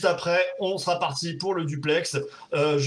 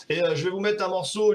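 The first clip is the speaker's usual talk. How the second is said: normal talking voice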